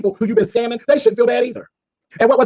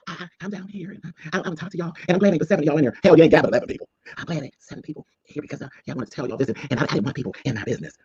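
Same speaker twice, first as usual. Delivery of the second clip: softer, mumbling imitation of Rico; mock meek Rico; 4 semitones lower, 5 dB quieter than usual. Mocking Rico as he complains to a tiny chat, much softer. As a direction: murmur it like a timid streamer.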